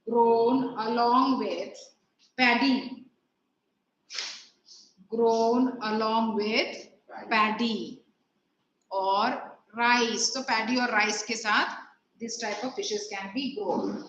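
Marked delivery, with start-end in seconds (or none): 0.1-10.3 very slow, phrase-by-phrase dictation; unhurried teacher reading out notes to copy; classroom dictation. Acted. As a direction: dictate with long pauses between chunks. Dictates the answer for writing.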